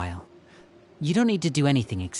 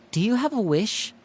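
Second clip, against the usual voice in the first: coaxing gently; 9 semitones higher than usual. Acted. Older brother coaxing a scared child to make a wish, gently. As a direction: lead her into imagining, gently.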